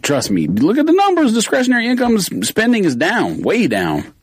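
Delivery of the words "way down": ordinary talk, nothing marked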